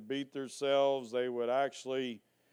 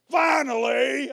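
loud, higher, slow shout of Finally; booming preacher shout; 10 semitones higher, 7 dB louder than usual. Real, not acted. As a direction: hammer the word Finally home from the pulpit. Preacher booming the key word.

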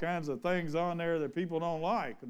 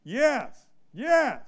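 a servant bursting to obey his king; zealous eager servant; 2 semitones lower, 4 dB louder than usual. Acted. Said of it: "That zealous".

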